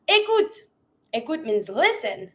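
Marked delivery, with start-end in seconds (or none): 0.1-0.7 high-pitched order to an animal; energetic command. Shown as a demonstration. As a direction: get the pet's attention, pitched high. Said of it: "We always try to be super energetic".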